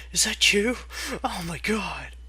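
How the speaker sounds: plain reading voice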